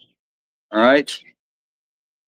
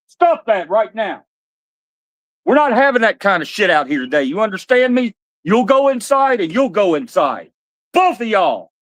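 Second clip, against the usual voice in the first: higher, firm telling-off; stern scolding; 7 semitones higher than usual. Real, not acted. Scolding the dogs after their fight, stern. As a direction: lay down the law like a dad. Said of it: "daddy raises his voice like that".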